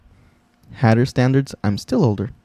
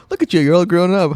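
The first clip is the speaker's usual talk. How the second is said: imitating host; 6 semitones higher than usual. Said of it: "That was a complete one eighty".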